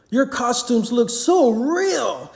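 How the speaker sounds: put-on high, slow child's voice; little girl exclaiming. A narrator's reading. Little girl admiring costumes, excited child. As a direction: gush like an amazed little girl. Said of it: "a little girl exclaimed".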